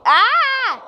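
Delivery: sharp high yelp; sudden yelp. Pretend. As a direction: let out a sudden cry. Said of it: "Sorry. That's my condition".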